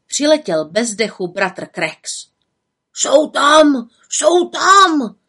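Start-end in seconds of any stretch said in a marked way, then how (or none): 2.9-3.9 loud, higher alarmed caw; crowing cry. Acted. Raven Krex arriving breathless, crying alarm. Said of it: "krákal už zdaleka". 4.1-5.2 louder, even higher alarmed caw; crowing cry, rising. Acted. Raven Krex calling from afar, louder alarm. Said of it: "krákal už zdaleka".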